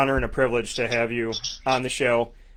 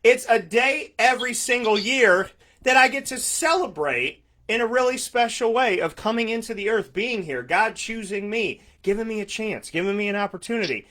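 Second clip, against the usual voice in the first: gushing joy; 8 semitones higher than usual. Real, not acted. Gushing about his birthday.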